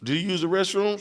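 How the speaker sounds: high questioning tone; stern parent. Retold or imitated. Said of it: "I'm like".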